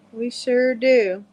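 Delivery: very slow, stretched southern drawl. Shown as a demonstration. Showing a friend how southerners talk, slow southern drawl. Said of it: "We all talk like this down south".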